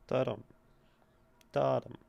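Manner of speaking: "Da-dum" as a trochee, first beat stressed; trochee rhythm. Shown as a demonstration. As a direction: tap out the trochee. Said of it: "That feels like a trochee".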